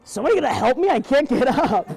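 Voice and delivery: loud, laughing call for help. Acted. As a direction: holler for help from the sack. Elf stuck in sack, calling.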